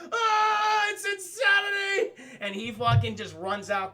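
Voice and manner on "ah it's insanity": high, loud cry of alarm, slowed on insanity; panicked shout. Retold or imitated. Old gargoyle shocked by screaming guitar, shouting high. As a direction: yelp it out like the TV is attacking you.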